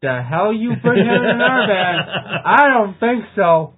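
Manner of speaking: loud, slow, mock-outraged through laughter; laughing outrage. Real, not acted. Drummer scoffs at Sabretooth singing, laughing and loud. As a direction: refuse it with laughing indignation. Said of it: "laughing defiance".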